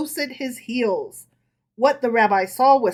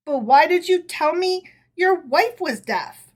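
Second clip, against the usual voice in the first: rasped; 6 semitones higher, 3 dB louder than usual. A narrator's reading. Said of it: "rasped in a hoarse voice".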